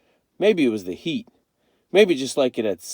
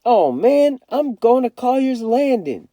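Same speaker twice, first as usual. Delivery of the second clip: high, loud stubborn declaration; defiant kid; 13 semitones higher, 6 dB louder than usual. A narrator's reading. Kenny tells his siblings where he's going, defiant. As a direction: announce it like a dare.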